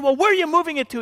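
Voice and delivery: high-pitched mock indignation; outraged neighbor lady. Neighbor lady grilling Gary about the tree, high indignant voice. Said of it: "And she said".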